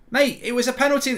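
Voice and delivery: ordinary delivery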